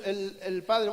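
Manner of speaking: usual speaking voice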